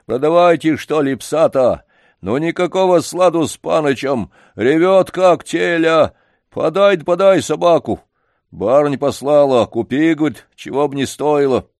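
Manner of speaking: a flustered servant nagging loudly; pushy janitor. Acted. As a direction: press the old man as a worked-up janitor. Janitor voiced in story.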